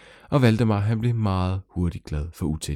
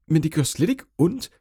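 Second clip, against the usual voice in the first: usual speaking voice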